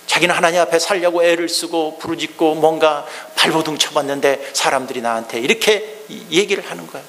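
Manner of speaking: ordinary talk, nothing marked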